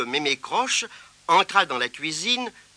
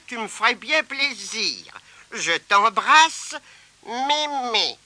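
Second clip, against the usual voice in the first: put-on high, slow, affectionate old woman; grandmother's loving note to Tirondin; granny's letter; 7 semitones higher, slower than usual. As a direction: read it as the grandmother. Grandmother's note read aloud.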